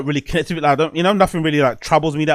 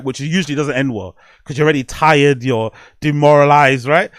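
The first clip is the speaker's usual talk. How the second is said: ordinary talk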